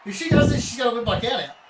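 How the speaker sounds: high, loud hyped yelling; excited shout. Real, not acted. Excited call of match.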